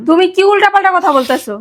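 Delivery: loud, high, stumbling outburst; upset protest. Acted. Girlfriend protesting boyfriend's rejection, upset and loud. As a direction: stammer into a loud, disbelieving protest.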